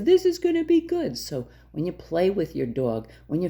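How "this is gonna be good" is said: high, bright, happy dog thoughts; eager dog voice. Retold or imitated. Dog hearing its name, excited for something good. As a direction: sound like a dog expecting a treat. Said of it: "it says".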